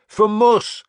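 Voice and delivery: high, loud yell; shouted war cry. A narrator's reading. Leader repeating the war cry, loud yell. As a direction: keep the cry going. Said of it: "I shouted".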